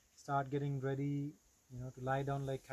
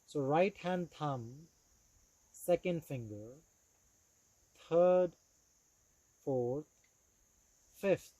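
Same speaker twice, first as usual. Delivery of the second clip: measured, deliberate yoga nidra guidance with long pauses; steady, clearly projected meditation instructor; guided meditation; 5 dB louder than usual. Acted. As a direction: guide them into relaxed awareness, phrase by phrase.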